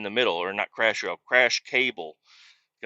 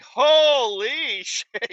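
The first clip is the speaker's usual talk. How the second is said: very slow, high, laughing through it; laughing disbelief; 13 semitones higher, 4 dB louder, much slower than usual. Retold or imitated. Driver laughing in shock at the crash, slow and high. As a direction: stretch it out, giggling in shock.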